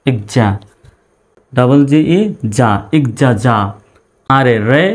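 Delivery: ordinary talk, nothing marked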